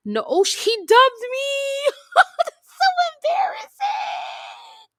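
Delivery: very high, loud squeal through laughter; mortified, drawn-out laughing shriek; real embarrassed laughing. Real, not acted. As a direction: squeal it, dragging the words out while laughing. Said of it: "that was embarrassing".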